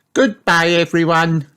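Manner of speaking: high-pitched cheery farewell; cheer. A narrator's reading. Peppa seeing her friends off, cheering. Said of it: "cheers Peppa".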